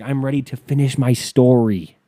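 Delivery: loud mock yell, imitating her; retold yell. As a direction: belt it out as her indignant protest. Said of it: "she yelled it".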